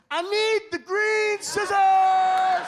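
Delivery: high, slow whiny shout; kid yelling for help. Acted. Left-handed kid shouting across the classroom, whiny and high. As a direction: wail for the scissors like a desperate kid. Callback shout in delivery room.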